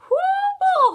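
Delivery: a high, loud whoo of delight; excited whoop. Real, not acted. Librarian cheering the kids after the song, high joyful whoop.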